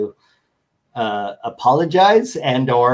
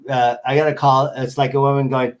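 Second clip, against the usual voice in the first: normal talking voice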